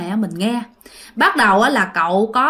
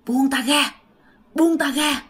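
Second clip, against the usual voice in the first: put-on raspy old man voice; 4 semitones higher than usual. A narrator's reading. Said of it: "giọng của cậu nó khàn đục".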